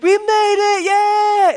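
high, loud mimicked kid cheer; child's excited cheer. Dad imitating daughter on arrival, high loud cheer. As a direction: squeal it like a thrilled kid arriving. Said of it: "and she's like".

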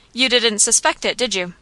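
plain reading voice

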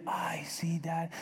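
whispered sheepish reply; daughter's whisper. Retold or imitated. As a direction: breathe it out, caught owing. Daughter dodges debt reminder.

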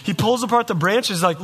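ordinary talk

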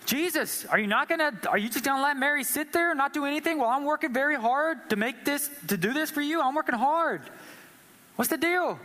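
high, exasperated complaint; indignant pleading with an edge; aggravated Martha. Retold or imitated. Martha complaining to Jesus about Mary, high and exasperated. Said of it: "she gets aggravated".

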